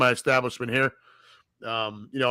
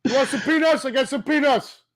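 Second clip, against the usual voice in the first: higher Bronx vendor's pitch; peanut vendor; 11 semitones higher than usual. Retold or imitated. Imitating the peanut vendors, a higher hawker's pitch. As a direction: sell peanuts like a stadium hawker. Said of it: "the peanut vendors at Yankee Stadium".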